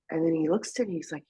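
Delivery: ordinary talk, nothing marked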